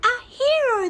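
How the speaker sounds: high, loud clucking cheer; clucked cheer. Acted. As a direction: cheer the hero like hens. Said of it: "they all clucked".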